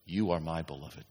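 soft, low, gentle reminder; a touch of God comforting the lonely; tender reminder. Acted. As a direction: murmur it as a loving God. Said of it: "the Lord says to you".

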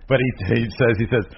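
normal talking voice

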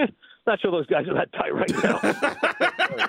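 slow, laughing hesitant doubt; mock hesitant brush-off, laughing; doubtful brush-off. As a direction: drag it out with a chuckle, unsure. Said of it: "They're like".

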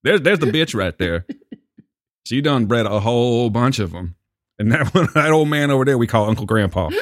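drawled Southern storyteller voice; Southern accent. Pretend. Joking about the dogs and Uncle Grandpa, Southern accent. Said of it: "he has an automatic Southern accent".

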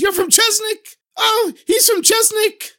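very high, drawn-out exclamations of delight; wildly excited. Acted. Master clapping at news of Chesnik, wildly excited. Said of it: "he was so excited. He was clapping his hands".

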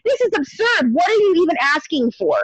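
high-pitched, indignant disbelief; exasperated retort, acted out; retold exasperation. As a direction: throw it back at an absurd demand. Retold reaction to escalating demands.

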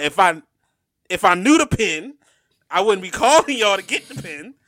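loud, high-pitched indignant retelling; exasperated retort. Retold or imitated. Exasperated retort to Sprint staff.

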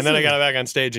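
ordinary talk

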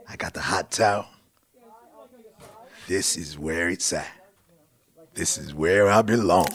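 usual speaking voice

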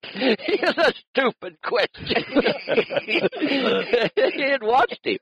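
high-pitched words squeezed out through laughter; can barely get it out for laughing. Real, not acted. Laughing while retelling Bud's reply.

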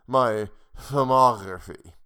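put-on drunkenly slurred, slow. A narrator's reading. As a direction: slur the word, tipsy and slow. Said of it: "She slurred the word".

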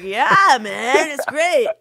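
loud mock-enthusiastic reply; imitated easygoing agreement. Host imitating a pointless call, loud mock agreement. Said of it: "It's like".

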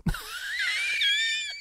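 high breathy laughing fit; squealing giggle. Real, not acted. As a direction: crack up at your own story. Laughing at own story.

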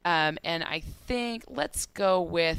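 slow, hesitant and unsure; unconfident. Real, not acted. Picking between his confidence picks, real hesitation. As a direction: draw it out, still deciding. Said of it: "You don't sound confident".

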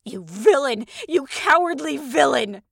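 high, loud cry of outrage; outraged wife. Acted. Lady denounces her cowardly husband, outraged. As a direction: hurl the insult in a high woman's voice.

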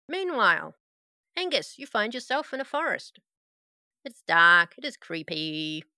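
ordinary delivery

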